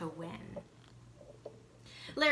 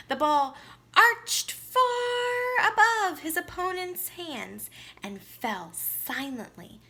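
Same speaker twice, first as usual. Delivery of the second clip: excited; 7 semitones higher, 4 dB louder than usual. A narrator's reading. Climax of the winning shot.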